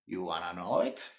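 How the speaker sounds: ordinary delivery, nothing marked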